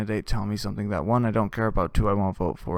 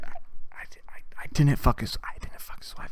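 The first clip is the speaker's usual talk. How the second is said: whispered frightened denial; scared whisper; 2 semitones higher, 4 dB quieter than usual. Retold or imitated. Terrified buddy denying the affair, whispered.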